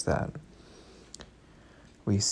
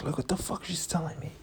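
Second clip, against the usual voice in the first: higher, hushed aside to a friend; incredulous whisper to the roommate; 8 semitones higher, 5 dB quieter than usual. Retold or imitated. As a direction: whisper it in disbelief. Whispering to roommate about her.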